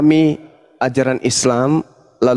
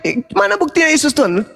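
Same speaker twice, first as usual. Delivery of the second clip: preaching; 13 semitones higher than usual. Acted. Heated religious debate preaching.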